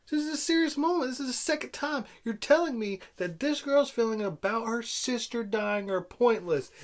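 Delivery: high-pitched exasperated protest; sarcastic, high-strung indignation; indignant rant. Real, not acted. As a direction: rant in disbelief, voice pushed way up. Indignant rant at pointless jokes.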